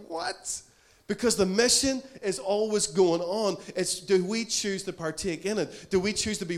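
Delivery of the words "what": high-pitched, disbelieving what; stunned one-word reaction; astonished. Acted. Preacher acts out astonished reaction.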